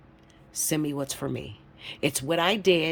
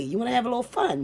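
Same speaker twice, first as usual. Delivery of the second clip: ordinary talk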